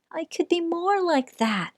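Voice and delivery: a shy little mouse sighing to himself; small mouse voice. Acted. Mouse wishes quietly.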